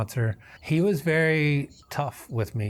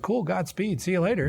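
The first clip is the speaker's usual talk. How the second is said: airy, higher, breezy shrug of a goodbye; mock nonchalant; 7 semitones higher than usual. Sponsor mimicked shrugging off his leaving wife, higher and breezy. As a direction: toss it off like it means nothing. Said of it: "and you're just like".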